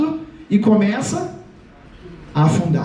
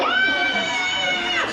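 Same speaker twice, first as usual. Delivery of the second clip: very high, drawn-out shriek; hammed-up high panic scream; little-voice scream. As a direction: squeal in exaggerated terror. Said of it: "Era vozinha mesmo".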